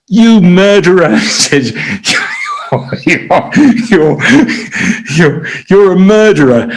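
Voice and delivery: angry prisoner screaming at the doctor, retold as a mild mock yell. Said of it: "he started screaming".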